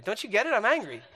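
mock exasperation. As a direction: snap back in comic frustration. Retold quarrel, exasperation building.

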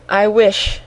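ordinary delivery, nothing marked

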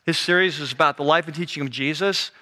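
ordinary delivery

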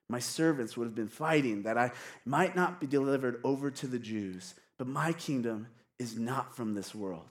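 low, soft scripture reading; calm, unhurt. Acted. Reads Jesus' unhurt answer to Pilate.

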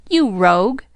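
high-pitched, scandalized outcry; shocked, high reproach; indignant cry. A narrator's reading. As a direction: cry out at a friend's betrayal. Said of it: "cried the three girls".

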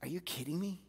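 soft, low incredulous voice; quietly, almost under the breath; incredulous God. Retold or imitated. God's inner voice rebuking the angry preacher, soft and incredulous. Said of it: "voice of God just saying".

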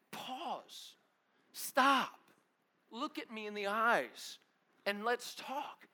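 high, slow, deliberate appeal; insistent. Retold or imitated. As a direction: make a buddy stop and listen, word by word. Insisting his buddy stop working.

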